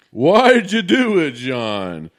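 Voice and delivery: high, loud drawn-out taunt; hammed-up cry to the ghost; stretched high taunting call; mock taunt. Replaying the taunt.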